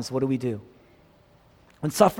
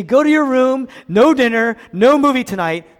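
loud, higher scolding, racing at the end; scolding parent; 9 semitones higher, 11 dB louder than usual. Retold or imitated. Preacher acts a parent punishing a lying child, loud scold. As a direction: bark it out like a stern parent.

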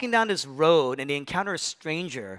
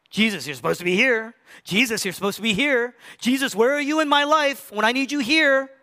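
mock complaint building higher and louder; whiny demanding prayer; 7 semitones higher, 4 dB louder than usual. A believer whining at Jesus to show up, mock demanding. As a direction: nag Jesus like a customer calling a waiter. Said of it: "You want a waiter".

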